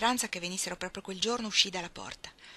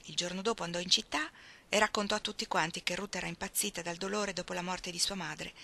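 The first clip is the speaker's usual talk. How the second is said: plain reading voice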